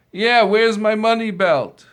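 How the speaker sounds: loud, high accusing shout; angry demand. Acted. Owner yelling at the accused man for his belt. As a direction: demand it back, furious.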